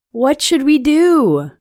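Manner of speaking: lightly voiced worried kids calling out; children crying out. A narrator's reading. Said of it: "The children cried out".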